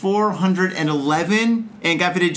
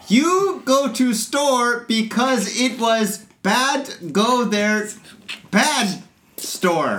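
put-on Japanese accent; 5 semitones higher, much slower than usual. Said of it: "Japanese girl is speaking English".

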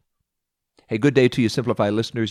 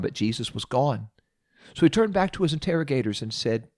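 usual speaking voice

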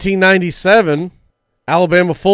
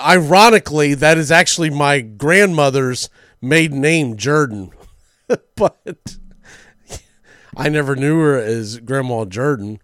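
ordinary delivery

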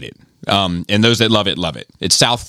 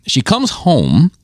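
ordinary delivery

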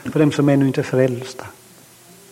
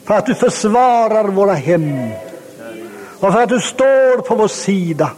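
fervent prayer; 6 semitones higher than usual. Acted. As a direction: praise prayer building to a high, ringing pitch. Leads a closing prayer of praise.

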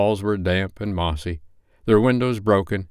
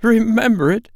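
much higher, louder eager exclamation; fervent cry; 6 dB louder than usual. Acted. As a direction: burst out with eager fervor. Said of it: "cried Scrooge with fervor".

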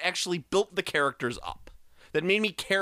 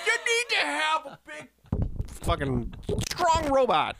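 animated rant; 11 semitones higher than usual. Real, not acted. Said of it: "as you talk angrily".

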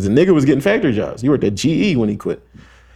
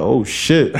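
crowd reaction. Retold or imitated. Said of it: "started singing in the crowd like".

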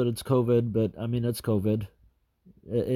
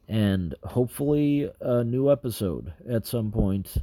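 normal talking voice

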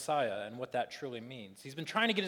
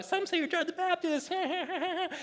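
very high, silly skit voice breaking into fake laughter; overacted mock-jolly disciple; silly bad acting; slower than usual. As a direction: squeal it like a terrible sketch actor. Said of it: "it's really silly and just bad acting".

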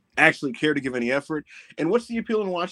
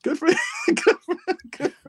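high, giggling good for them; amused repetition breaking into laughter; laughing; 4 dB louder than usual. Real, not acted. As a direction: crack up while repeating it. Laughing at absurd ending.

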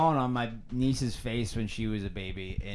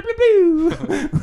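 mock soundboard shout; 13 semitones higher, 9 dB louder than usual. Soundboard noise bit.

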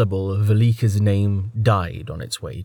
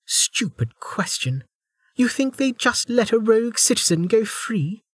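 higher, scornful woman; 12 semitones higher than usual. Acted. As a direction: scoff at the question. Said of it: "Velika snorted".

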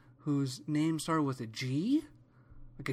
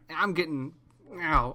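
ordinary delivery

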